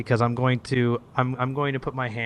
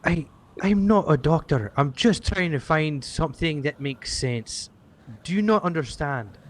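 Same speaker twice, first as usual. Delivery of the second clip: exasperated character; 5 semitones higher, 3 dB louder than usual. Acted. Character confronts Mother Ashton.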